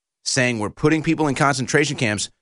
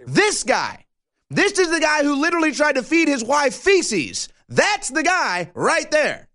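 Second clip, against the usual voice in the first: contemptuous jeer; 12 semitones higher than usual. Real, not acted. Mocking politician on screen.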